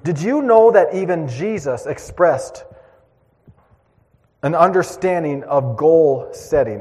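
slow, emphatic pulpit call; preaching. Acted. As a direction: urge the congregation to set goals. Preaching exhortation.

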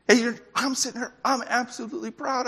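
high, fast proud father; a father bursting with pride, high and quick; proud dad. Retold or imitated. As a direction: gush like a proud dad watching first steps. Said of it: "and you're like going".

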